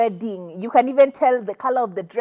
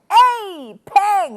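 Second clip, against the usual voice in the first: excited exclamation; 8 semitones higher than usual. Retold or imitated. Love at first sight.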